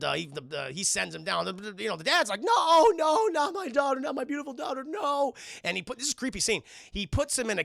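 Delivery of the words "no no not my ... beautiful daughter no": high, mock-desperate wail; anguished dad. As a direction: cry out like a father losing his child. Dad wails as he's sent away.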